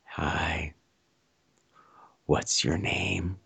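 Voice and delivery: slow, low whisper; creepy husky murmur right in the ear. Retold or imitated. Stranger pinning him in the tunnel, husky whisper. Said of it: "there's this husky voice in my ear".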